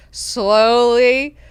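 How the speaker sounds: exaggerated, lingering "slowly"; drawn-out slowly. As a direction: drag out "slowly" to match the creeping exit. Word repeated, stretched out for effect.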